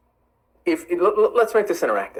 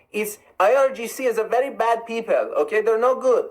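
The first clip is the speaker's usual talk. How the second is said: hammed-up Persian accent, higher-pitched and emphatic; 4 semitones higher than usual. Shown as a demonstration. Streamer showing listeners what a Persian accent sounds like, Persian accent. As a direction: put on a thick demo Persian accent. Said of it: "what a Persian accent sounds like".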